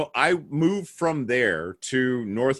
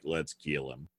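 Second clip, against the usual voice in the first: classmates; 8 semitones lower, 12 dB quieter than usual. Retold or imitated. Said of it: "they're all like".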